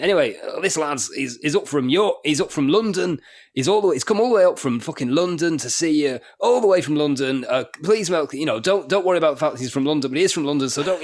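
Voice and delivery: loud, high mock compere voice, ranting at the crowd; aggressive compere. Compere heckling the crowd about the act from London, loud mock rant. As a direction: bellow it like an irritated compere. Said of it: "And then he went".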